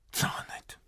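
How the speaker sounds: unvoiced whisper to himself; worried whisper to himself. Real, not acted. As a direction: breathe it out, no voice. Phone call won't connect.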